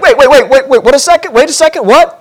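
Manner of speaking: rising, startled wait wait wait; flustered wait. Pretend. Pastor as Abram, startled by God's words, high rising protest. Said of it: "I would be like".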